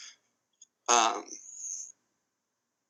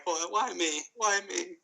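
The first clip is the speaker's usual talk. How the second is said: higher, softer mock whine of self-pity; mock crying; 7 semitones higher, 7 dB quieter, slower than usual. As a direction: whimper it like a teased crybaby. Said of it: "Big Pop's crying".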